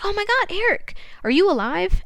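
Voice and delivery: high-pitched acted shock and alarm. Susan finds Eric in the bunker, acted shock. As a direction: gasp it out as a stunned discovery.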